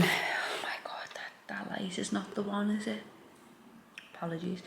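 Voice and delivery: soft whispered aside; embarrassed whisper. Real, not acted. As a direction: hush it, a little embarrassed.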